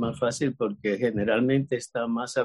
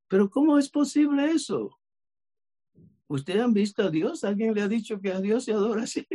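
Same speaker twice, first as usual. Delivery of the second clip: mock challenge; 8 semitones higher than usual. Challenging the man's worship.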